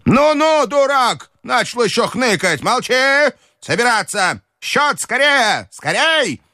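put-on very high, imperious old-lady scolding; furious grandmother. A narrator's reading. Grandmother silencing servant and demanding the bill, high and irate. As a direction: cut him off and hurry everyone, pitched high. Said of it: "чтобы остановить её фурор".

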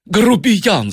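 high, indignant exclamation; red-faced outrage; angry. A narrator's reading. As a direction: spit out the insult in anger. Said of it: "Сомс был весь красный от гнева".

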